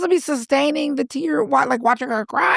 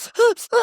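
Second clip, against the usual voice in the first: quick shocked gasp, high; sharp gasp; 11 semitones higher than usual. Retold or imitated. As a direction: gasp like you just saw something shocking. Acting out others' reaction.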